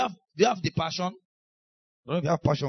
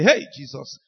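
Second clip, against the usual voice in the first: shocked exclamation; 2 semitones lower, 7 dB louder than usual. Retold or imitated.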